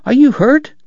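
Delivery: high, urgent question; alarmed cry. A narrator's reading. Doc checking on Kate after the fall, crying out. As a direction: pitch it up in alarm, rising at the end. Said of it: "he cried".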